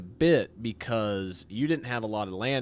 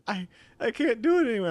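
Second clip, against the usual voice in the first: higher, fast, worn-out whine; exhausted complaint; 11 semitones higher, 6 dB louder, faster than usual. Retold or imitated. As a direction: beg to stop the punishment. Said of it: "he's like".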